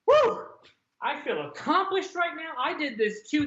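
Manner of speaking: high, whooping mock triumph; triumphant cheer. Viewer whooping after finishing Daredevil, high mock triumph. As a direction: cheer like you finished the whole season yourself.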